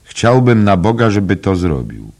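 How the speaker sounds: loud, high cry, easing slightly at the end; shouted outburst. A narrator's reading. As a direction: exclaim it vehemently. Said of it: "krzyknął pan Dedalus". (gwałtowny, głośny okrzyk)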